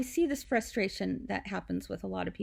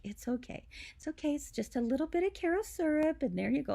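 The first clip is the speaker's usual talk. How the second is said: soothing nurse; 5 semitones higher, faster than usual. Retold or imitated. Nurse soothing a crying mom.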